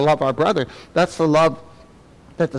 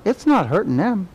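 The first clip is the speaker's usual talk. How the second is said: ordinary talk, nothing marked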